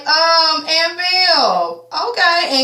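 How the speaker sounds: high startled okay; taken aback. Retold or imitated. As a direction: react like you can't believe she said it. Reacting to Viv's threat.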